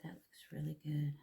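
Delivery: whispering. Real, not acted. Whispered aside while applying.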